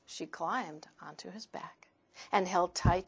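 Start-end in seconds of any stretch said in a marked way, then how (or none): 0.0-1.9 soft whispered narration; gentle whisper. A narrator's reading. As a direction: hush it for the listeners. Hushed narration.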